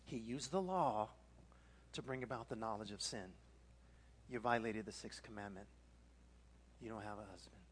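low, soft, solemn delivery; subdued, weighty near-murmur, ending in a hush; hushed gravity. Real, not acted. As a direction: let each sentence sink in quietly. Solemn telling of sin exposed.

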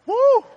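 high, loud breathless whoop; whoo of exhaustion. Real, not acted. Preacher to congregation, winded loud whoop. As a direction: blow it out after the big climax.

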